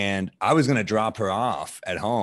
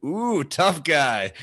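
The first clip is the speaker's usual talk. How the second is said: slow, higher mocking coo; retold teasing; 4 semitones higher, 4 dB louder, much slower than usual. Teasing a tough comic over his Baileys, mock awe. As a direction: needle him, drawing it out slowly.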